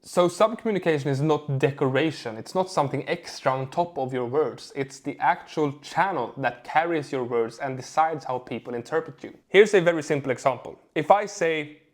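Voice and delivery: usual speaking voice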